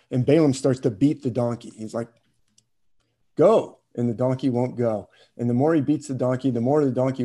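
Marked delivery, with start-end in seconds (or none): 3.4-3.8 shouted command. Retold or imitated. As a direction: yell it like driving on a stuck animal. Balaam beating the stubborn donkey.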